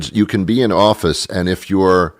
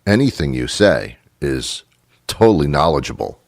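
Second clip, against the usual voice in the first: ordinary delivery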